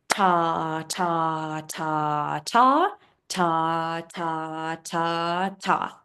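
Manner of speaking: even ta on each beat; steady beat count. Shown as a demonstration. Teacher counting quarter notes, even steady ta. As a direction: tap out ta on every beat. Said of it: "Here it is on the rhythm staff".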